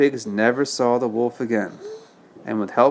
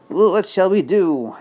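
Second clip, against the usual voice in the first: worried pig question; 7 semitones higher than usual. Acted. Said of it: "asked Boris".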